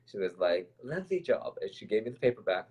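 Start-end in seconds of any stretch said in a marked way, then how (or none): 0.8-1.6 higher-pitched, badly imitated British teacher; bad British accent. Teacher hands back the paper, bad British accent. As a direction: praise briefly in a put-on British accent. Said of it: "in her lovely British accent".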